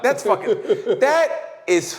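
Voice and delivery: real laughter, slow; laughing. Real, not acted. Cracking up at his friend's joke, laughing. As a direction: laugh through it.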